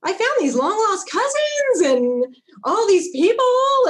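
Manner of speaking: squealing high and loud with delight; high loud thrilled friend, slowed and drawn out; excited friend. Retold or imitated. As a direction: gush like a thrilled friend at the news. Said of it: "was like".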